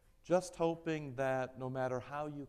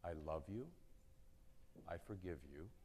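tender reassurance; 6 semitones lower, 10 dB quieter than usual. Acted. Pastor voicing a parent's loving words, low and soft. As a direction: lower and softer, gentle parent. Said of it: "same words that have been spoken to your parents".

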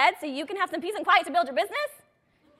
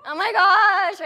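louder, higher mock-panicked gasp of words; 5 semitones higher, 9 dB louder, much slower than usual. Coach mimics a nervous seller's hammed-up panic. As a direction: ham up the nervous seller's dread.

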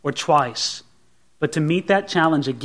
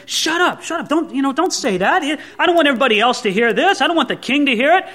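fast and high, urgent; panicked hushing; 5 semitones higher, faster than usual. Acted. Abner hurrying to hush David so the soldiers won't hear, panicked. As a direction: hush him before anyone hears.

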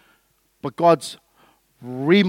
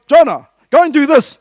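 loud, higher commanding call; God commanding; 7 semitones higher, 10 dB louder than usual. Retold or imitated. God ordering Jonah to go, loud and commanding. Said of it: "I go".

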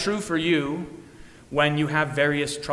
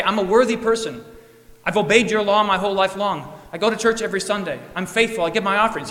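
higher, louder, proud listing of merits; boastful self-praise; 4 semitones higher, 5 dB louder, faster than usual. Retold or imitated. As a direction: puff yourself up before Jesus. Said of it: "He doesn't say".

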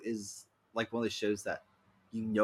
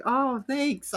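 bubbly thanks; 4 dB louder than usual. Retold or imitated. Speaker answering a compliment.

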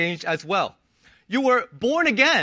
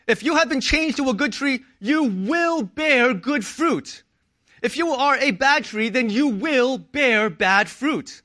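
preaching emphasis; 4 semitones higher than usual. Acted.

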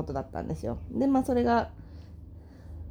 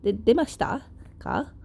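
normal talking voice

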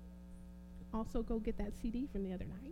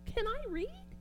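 the question again, jumped much higher; playful squeaky rhetorical question; mock high question; 12 semitones higher than usual. Shown as a demonstration. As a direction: toss it up high, teasing the congregation. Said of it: "Can y'all read?".

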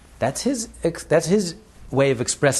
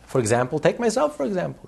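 rich man; 7 semitones higher than usual. Retold or imitated. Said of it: "He says".

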